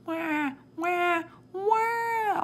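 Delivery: high, imitated baby crying; baby wail. A narrator's reading. Timothy screams in the background, baby wailing.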